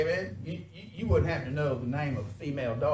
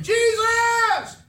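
high, drawn-out full-voice shout; loud pleading holler; crying out; 10 dB louder, much slower than usual. Acted. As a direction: cry out desperately for mercy, stretching each word. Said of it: "he cried, saying".

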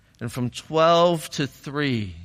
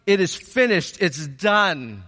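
raised pitch, preaching declaration; emphatic proclamation; 5 semitones higher than usual. Real, not acted. Preacher telling the church salvation is done, emphatically. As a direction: proclaim it as settled fact.

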